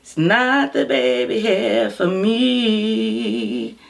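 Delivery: high slow sassy drawl. Pretend. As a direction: draw it out and lean on not. Higher, slower catchphrase take.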